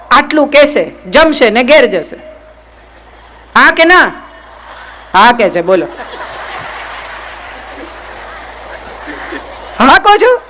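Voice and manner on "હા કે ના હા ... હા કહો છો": laughing tease. Real, not acted. Teasing audience who say yes.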